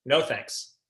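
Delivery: ordinary talk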